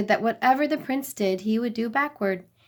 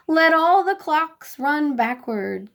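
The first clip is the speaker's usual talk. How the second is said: higher, slow kingly proclamation; royal decree; 6 semitones higher, 4 dB louder, slower than usual. A narrator's reading. King Wacky decreeing to his land, slow and grand. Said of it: "King Wacky decreed".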